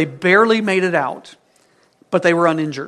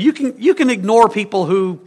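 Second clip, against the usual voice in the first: usual speaking voice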